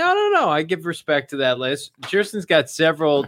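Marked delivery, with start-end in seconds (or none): 0.0-0.5 protesting. Real, not acted. Disagreeing loudly with host's list.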